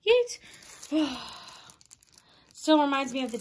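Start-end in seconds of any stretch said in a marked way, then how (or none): none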